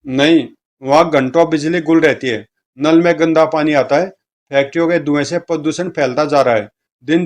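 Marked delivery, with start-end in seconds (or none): none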